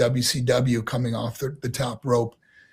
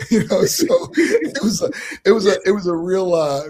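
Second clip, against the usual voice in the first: laughing; 6 semitones higher, 5 dB louder than usual. Real, not acted. Laughing about acting Southern.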